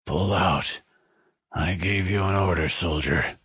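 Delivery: weak, slurred, low dying voice. Acted. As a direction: mumble the order, barely there. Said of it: "The voice was weak and sounded slurred".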